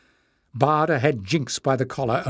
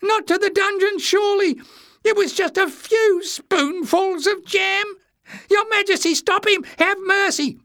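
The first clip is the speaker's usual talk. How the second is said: very high squealing pleas; high-pitched, frantic alarm; panicked pleading; 4 dB louder than usual. A narrator's reading. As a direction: beg for mercy in a loud squeak. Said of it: "he cried in panic".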